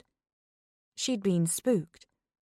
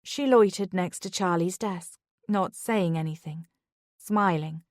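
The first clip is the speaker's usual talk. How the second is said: ordinary delivery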